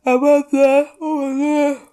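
high, loud words through a yawn. Real, not acted. Podcast host caught by a yawn mid-intro, yawning. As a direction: talk right through a yawn. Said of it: "I hate yawning".

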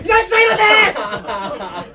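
shouted high shop welcome; bright loud shop welcome. Shown as a demonstration. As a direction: greet customers at full volume. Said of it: "大きな声で". (全力で叫ぶいらっしゃいませ)

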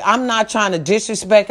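normal talking voice

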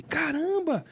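high-pitched exclamation of recognition; retold amazement. Pastor recognising the father's name, acted surprise. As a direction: imitate someone astonished at a familiar name.

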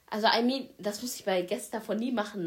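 normal talking voice

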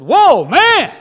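loud astonished whoa, man; amazed Adam. Retold or imitated. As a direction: cry it out like a man seeing a woman first time. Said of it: "Adam woke up and saw a woman and said".

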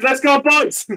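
loud, high triumphant shout; excited cheer. Real, not acted. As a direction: cheer the win at the table. Cheering a big roll.

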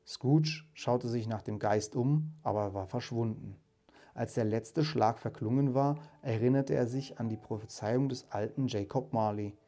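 measured storytelling voice, lightly acting the ghost and Scrooge; story narration. A narrator's reading. Narrator reading A Christmas Carol to listeners, storytelling voice. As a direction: read the tale like a fireside audiobook. Reads Dickens aloud, voicing characters.

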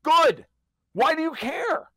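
worked-up rant, voice climbing high; fired-up sarcastic tirade, pitched high; heated rant. Real, not acted. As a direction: rant with rising outrage.

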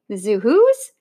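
ordinary delivery, nothing marked

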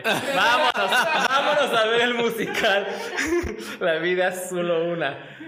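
high, loud, laughing through the words. Retold or imitated. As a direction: cackle while deciding to go. Retold spur-of-the-moment decision.

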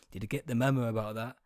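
normal talking voice

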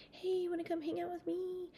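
high, soft, coaxing creep voice; creepy stranger. Retold or imitated. Creep from the video coaxing a young kid, high and soft. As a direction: lure the kid in like a predator.

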